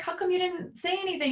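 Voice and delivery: soothing mother tone; gentle high question. Retold or imitated. Mom asking sobbing son.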